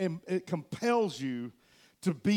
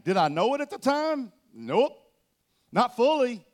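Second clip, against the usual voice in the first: ordinary delivery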